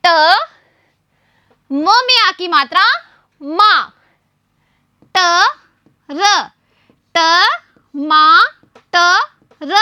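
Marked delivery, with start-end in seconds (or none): none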